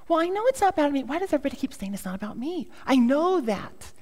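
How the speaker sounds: high-pitched mock exasperation; exasperated. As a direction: protest as if told once too often. Acted exasperation at repeated advice.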